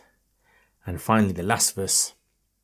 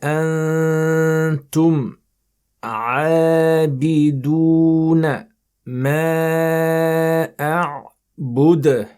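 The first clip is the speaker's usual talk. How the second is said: slow, melodic tajweed chant, higher; Quran recitation; 5 semitones higher, 5 dB louder, much slower than usual. Shown as a demonstration. Teacher reciting for students, melodic tajweed chant.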